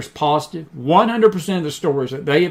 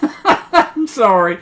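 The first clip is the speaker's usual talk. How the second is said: laughing; 4 semitones higher, much slower than usual. Real, not acted. Apologizes while laughing.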